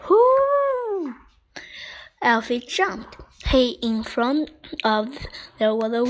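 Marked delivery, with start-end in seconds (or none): 0.1-1.2 very high, drawn-out howl; imitated high wolf call; answering wolf howl. A narrator's reading. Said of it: "someone answered him".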